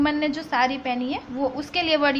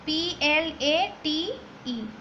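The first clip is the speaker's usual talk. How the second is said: spelling letters slowly; 4 dB quieter, much slower than usual. Shown as a demonstration. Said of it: "plate".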